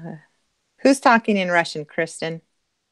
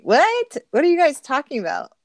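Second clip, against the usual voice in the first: surprised; 8 semitones higher, 3 dB louder than usual. Pretend. Mock surprise at accusations.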